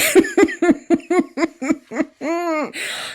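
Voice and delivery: high bursting laugh at the scene; real laugh. Real, not acted. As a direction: let a real laugh break out. Recapping favorite funny scene.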